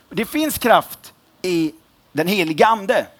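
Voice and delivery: slow, resonant preacher's delivery; preaching voice. Real, not acted. Preacher proclaiming to the congregation, slow preaching voice. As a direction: proclaim it slowly from the pulpit. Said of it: "Min röst är lite så".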